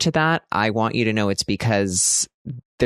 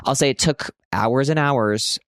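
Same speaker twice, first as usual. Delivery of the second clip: usual speaking voice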